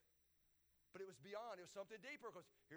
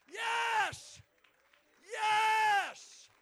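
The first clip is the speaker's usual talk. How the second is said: very high, very loud cry; exultant shout; 21 dB louder than usual. Real, not acted. Preacher celebrating Rahab as Jesus' ancestor, exultant shouts. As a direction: holler it with full force.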